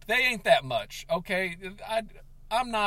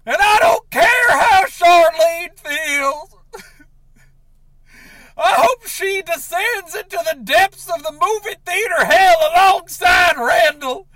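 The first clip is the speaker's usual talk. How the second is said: much louder and higher, shouting through tears; sobbing rage; 11 semitones higher, 13 dB louder than usual. Acted. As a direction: yell it out, crying with fury. Furious rant at ex-wife.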